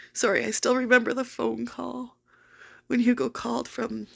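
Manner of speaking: fighting back tears over the lost dog; real crying, voice cracking; tearful. Real, not acted. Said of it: "Sorry, I've been crying so much".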